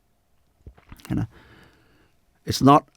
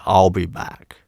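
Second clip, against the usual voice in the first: a hint of the famous action-hero growl; understated Terminator catchphrase impression; Arnold Schwarzenegger; 4 semitones lower than usual. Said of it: "Arnold Schwarzenegger".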